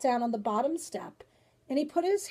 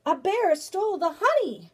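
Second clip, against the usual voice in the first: high, loud alarmed report; crying out; 8 semitones higher, 5 dB louder than usual. Acted. Grandfather telling his wife about the bear, crying out. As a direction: cry out the alarming news. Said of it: "he cried".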